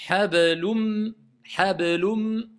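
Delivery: "hablum" with the n merged into a held m; "hablum" ending on a lengthened nasal m; assimilated nun. Shown as a demonstration. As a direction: hum the closing m of "hablum". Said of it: "je ne prononce plus le N".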